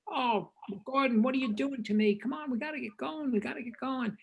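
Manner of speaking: high, pleading whine at a colleague; mock-exasperated director urging the crew on; exasperated plea. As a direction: beg him to hurry up, half-joking. Coppola pleading with cinematographer.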